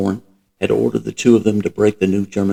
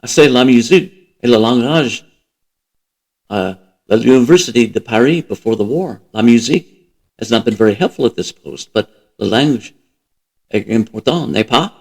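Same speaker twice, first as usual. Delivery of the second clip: put-on thick Parisian accent, louder and lilting; heavy Parisian accent; 3 semitones higher, 5 dB louder than usual. A narrator's reading. Frenchwoman explains her studies to the American, heavy Parisian accent. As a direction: speak English and French as a heavily accented Parisienne. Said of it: "said in her heavy Parisian accent".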